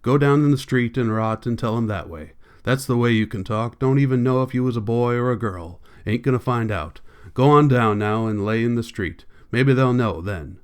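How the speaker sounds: plain reading voice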